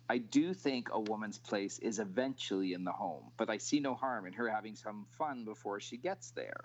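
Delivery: fast quote reading; quick quote read. Acted.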